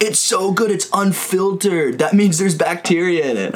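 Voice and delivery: mock-excited, high-pitched gushing; mimicking enthusiasm. Friend raving about his unfiltered beer, mock-enthusiastic.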